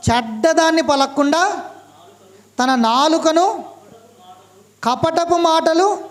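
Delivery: slow, measured reading of a Bible verse; scripture reading. Acted. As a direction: read each phrase slowly, with pauses between phrases. Reads the scripture verse aloud. (నెమ్మదిగా వాక్య పఠనం)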